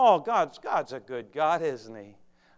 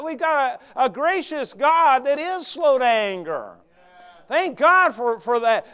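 loud, high, driving preaching; preaching at full force; 9 semitones higher, 16 dB louder than usual. Acted. Preacher voicing Jonah's bitterness to the flock, full force. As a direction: hammer the congregation with it.

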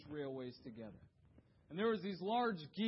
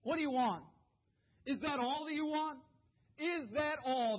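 louder, higher pointed questions; challenging preaching; 9 semitones higher, 6 dB louder than usual. Acted. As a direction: challenge the congregation.

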